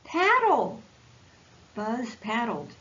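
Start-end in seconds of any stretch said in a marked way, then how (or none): 0.0-0.8 urgent shout. Acted. Coaching friend in water.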